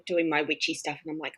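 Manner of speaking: normal talking voice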